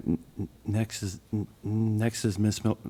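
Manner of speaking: stuck on the first sound, repeating it; stammering through the name; stuttering. Real, not acted. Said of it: "I'm stuttering again".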